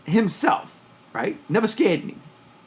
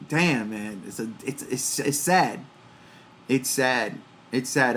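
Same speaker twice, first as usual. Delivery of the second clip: 1 sad, low and soft; 8 semitones lower, 5 dB quieter than usual. Real, not acted. Mourning Pee-wee's death.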